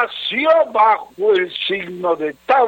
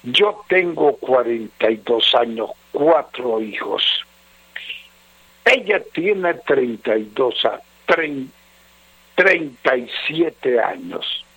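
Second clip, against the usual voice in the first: low, subdued letter-reading voice; 6 semitones lower than usual. Acted. Radio adviser reading a troubled husband's letter, low and measured. Reads listener's letter aloud.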